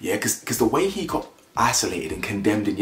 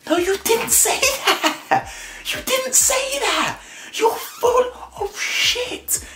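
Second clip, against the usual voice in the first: very high, slow outraged shriek; mock shriek; slower than usual. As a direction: screech it back in high disbelief. Shrieking retort, huge pitch.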